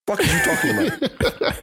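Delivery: high, laughing, incredulous; laughing disbelief. Real, not acted.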